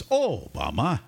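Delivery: Obama with the O set apart and stretched; Irish-style O'Bama. Shown as a demonstration. Host trying Obama as an Irish surname, Irish-style pronunciation. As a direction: lean on the O of Obama, then a little break before bama. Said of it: "little accent right after the O".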